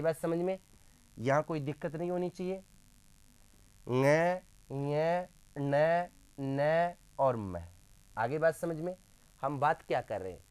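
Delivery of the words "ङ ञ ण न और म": ng, य, न, न, म sounded one by one, य as nya; nasal-letter drill. Shown as a demonstration. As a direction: recite the five nasals spaced out: ng, य, न, न, म. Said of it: "इसका उच्चारण कैसे करेंगे?". (ng य न न म अलग-अलग नाक से बोलें)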